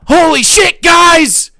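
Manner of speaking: very high, loud yelling chatter; a high, loud shouted reaction; excited shout. Acted. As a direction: yell it like a freaked-out viewer. Reading an excited chat message.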